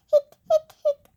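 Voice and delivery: high loud laughing hic sounds; hiccups with a laugh. Acted. Child acts out her hiccups, laughing. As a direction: hiccup three times, giggling.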